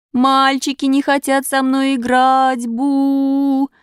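put-on slow, high, tearful little-girl whine; crying little girl wailing; whining child. A narrator's reading. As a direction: complain to mama through tears. Buba crying to mother.

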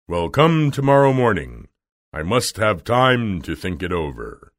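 imposing wizard putting them off; booming start, sinking in pitch; Oz's voice. Acted. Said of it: "said the wizard".

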